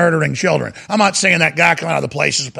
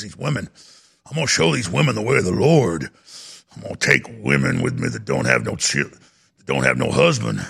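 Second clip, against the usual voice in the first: much lower, put-on rural hillbilly voice; 8 semitones lower than usual. Host imitating a man taking kids to Pennsylvania, low put-on hillbilly voice. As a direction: sink way down and play the Pennsylvania hillbilly. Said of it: "I'm not trying to talk like a hillbilly".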